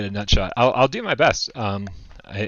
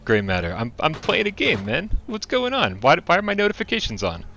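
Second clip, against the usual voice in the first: higher, startled and laughing; real surprise; 6 semitones higher than usual. Real, not acted. Streamer startled by an unexpected voice chat, surprised and laughing. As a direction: caught off guard, protesting half laughing.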